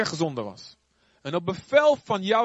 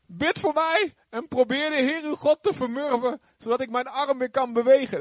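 much higher voice of a begging king; pleading; 8 semitones higher than usual. Acted. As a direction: beg humbly for help. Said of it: "smeekte de koning". (smekend tot de godsman)

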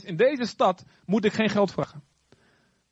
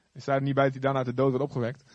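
ordinary talk

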